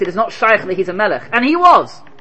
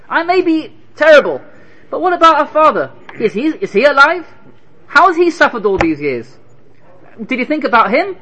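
rising plea; 8 semitones higher, 4 dB louder than usual. Acted. Yosef pleading rising.

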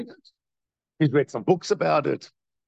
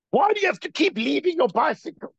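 mock nagging parent; 12 semitones higher than usual.

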